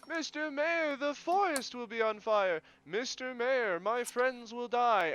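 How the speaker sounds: high, slow mock wail; mocking the doomsayers. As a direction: parrot the whiners with fake dread. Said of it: "many people today say".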